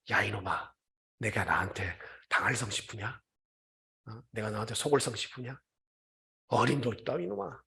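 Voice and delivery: low, soft scolding under the breath; inner rebuke to Satan. Retold or imitated. Preacher silently rebuking Satan, low and soft. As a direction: scold Satan quietly, inwardly. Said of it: "사탄에게 속마음으로 이렇게 얘기하는".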